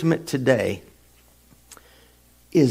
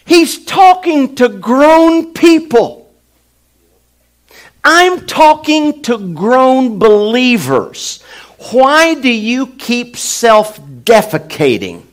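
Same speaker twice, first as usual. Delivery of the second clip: loud, high and slow preaching; full-force preaching; 10 semitones higher, 8 dB louder than usual. Real, not acted. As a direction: hammer each word from the pulpit.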